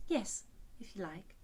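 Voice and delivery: breathy whispered yes; a shy little agreement. A narrator's reading. Alice accepting the unicorn's bargain in a whisper.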